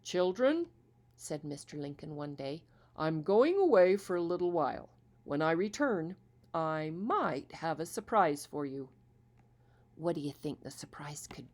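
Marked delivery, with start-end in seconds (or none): 0.0-0.7 put-on father calling children. Father addresses his children. 3.0-8.9 kind father voice. Acted. Father announces trip to children.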